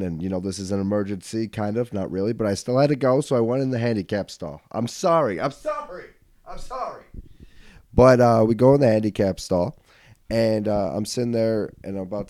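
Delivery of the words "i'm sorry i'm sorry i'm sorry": squeaky little apology, trailing away; mock apology. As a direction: plead sorry, shrinking into a small high voice. Mock apology, pitch rises and fades.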